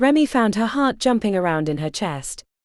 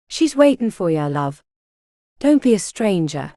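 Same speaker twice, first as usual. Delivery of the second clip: cheeky Cockney cat, friendly and casual; Cockney accent. A narrator's reading. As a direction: lay on a London Cockney accent. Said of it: "the cat spoke in a Cockney accent".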